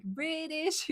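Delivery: "British" drawn out slowly, pitched high; slow, high, swooping "British"; exaggerated British lilt. Shown as a demonstration.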